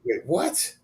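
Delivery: mildly stunned double-take, rising at the end; retold shock. Man recalling his friend's coming out, retold shock. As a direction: replay your own puzzled surprise, pitched a little up. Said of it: "I was shocked".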